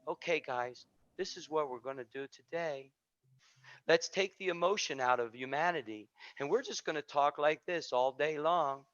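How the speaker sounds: mock a dull teacher with no feeling, low and soft; emotionless monotone.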